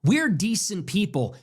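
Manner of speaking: usual speaking voice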